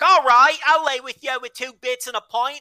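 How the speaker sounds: high-pitched, broad Cockney streetwalker; loud comic Cockney come-on; Cockney hooker accent. Acted. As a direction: do a hammed-up old British hooker in Cockney. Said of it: "like an eighteenth century British hooker".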